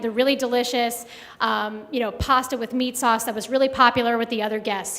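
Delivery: normal talking voice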